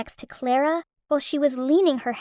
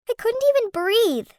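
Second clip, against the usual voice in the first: tearful; 8 semitones higher than usual. Acted. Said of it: "I burst into tears with anger".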